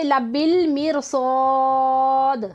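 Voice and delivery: मिरसाद with long held aa, closing on a vowelless d; sukoon at stop. Shown as a demonstration. Teacher shows the stop, मिरसाद recited long with final d unvowelled. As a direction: chant it slowly and stop dead on the d of मिरसाद. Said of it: "लेकिन ज़ेर हमने क्यों नहीं पढ़ी".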